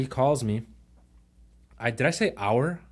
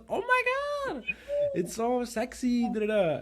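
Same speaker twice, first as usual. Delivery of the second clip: gushing admiration; 14 semitones higher than usual. Retold or imitated. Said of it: "she goes".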